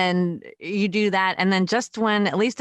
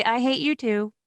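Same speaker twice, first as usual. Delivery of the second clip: higher, sing-song and sweet; sweet mock reply; 5 semitones higher than usual. Mom answering her son's I hate you, sweetly. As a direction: say it like a lullaby, not a threat. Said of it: "I'd say".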